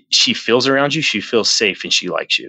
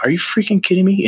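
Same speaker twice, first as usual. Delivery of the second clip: high incredulous question, volume held normal; retold disbelief; 9 semitones higher than usual. Retelling the unplugged pot heating up, high incredulous. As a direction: replay your disbelief with raised pitch.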